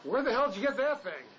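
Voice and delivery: alarmed disbelief; shocked. Acted. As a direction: caught off guard by the gun. Sees the old shotgun.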